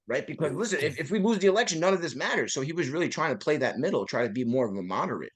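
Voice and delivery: ordinary talk, nothing marked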